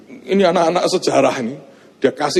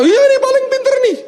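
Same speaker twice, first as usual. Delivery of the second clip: high, fast, teasing classmate voice; classmates' mock praise; 4 dB louder, faster than usual. Classmates pointing at him to the teacher, fast high mock praise. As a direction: hype him up like an eager classmate.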